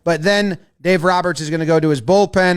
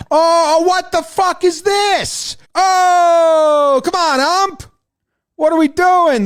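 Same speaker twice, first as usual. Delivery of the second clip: high-pitched protest at the umpire; drawn-out, indignant fan shout; acted outrage; 9 semitones higher, slower than usual. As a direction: cry out in disbelief at the call.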